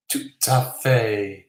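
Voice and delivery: lightly put-on French accent, drawn out. Quiz host reading out a viewer's French comment, light French accent. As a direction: read it slowly with a touch of French. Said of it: "reading things in French".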